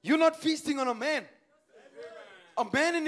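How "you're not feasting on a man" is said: ordinary talk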